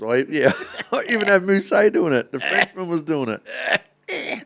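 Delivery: high laughing through the words. Real, not acted. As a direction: crack up while telling it. Laughing about sneezing teammate.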